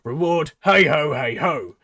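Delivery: loud, high sales pitch; showman pitch. Acted. Tabaxi pitching the job to the goblin, loud showman. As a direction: sell the deal with gusto.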